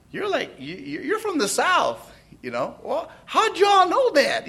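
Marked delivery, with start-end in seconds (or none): 2.8-4.5 Southern drawl. Retold or imitated. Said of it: "Southern drawl".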